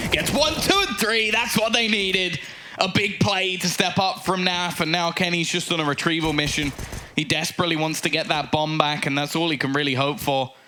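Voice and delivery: high-pitched burst, easing down as the play settles; live esports caster hyped by a big play; excited play-by-play. Real, not acted. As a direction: call the multi-kill with a rush of excitement, then gradually settle. Calling a sudden triple kill live.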